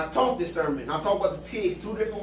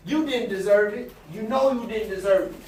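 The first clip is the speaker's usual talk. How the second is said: louder preaching build; 5 dB louder than usual. Real, not acted. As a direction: drive the sermon home. Preaching at full force.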